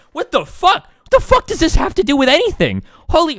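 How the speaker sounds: high, incredulous outburst; exasperated disbelief. Real, not acted. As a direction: break off appalled, pitch jumping up. Reacts to absurd viewer question.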